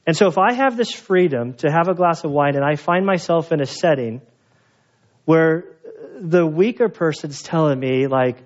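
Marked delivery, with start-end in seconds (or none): none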